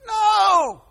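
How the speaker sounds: high, loud outraged no; emphatic shout. Acted. Preacher rejecting doting parents, loud outraged shout. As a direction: bellow the no to stop it cold. Repeats his answer.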